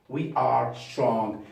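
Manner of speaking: We are strong, slow; slow model read. Shown as a demonstration. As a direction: say it slowly for pupils. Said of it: "And finally".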